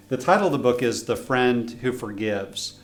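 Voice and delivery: usual speaking voice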